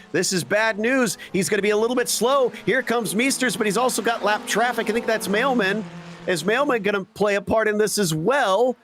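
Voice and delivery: high, fast, urgent play-by-play; excited race call. Real, not acted. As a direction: call the photo finish breathlessly. Tense finish, commentator calling the race.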